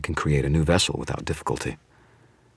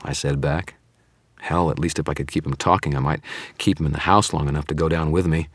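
usual speaking voice

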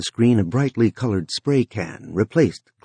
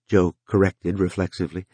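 ordinary delivery, nothing marked

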